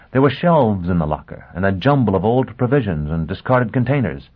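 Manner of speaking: ordinary delivery, nothing marked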